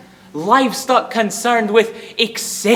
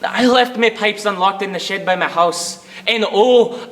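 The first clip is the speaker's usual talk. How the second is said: ordinary talk, nothing marked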